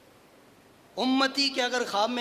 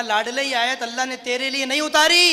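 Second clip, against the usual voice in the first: emphatic proclamation; 4 semitones higher, 6 dB louder than usual. Retold or imitated. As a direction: build to a loud, rising finish. Same consolation repeated for the crowd.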